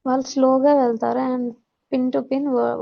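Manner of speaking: normal talking voice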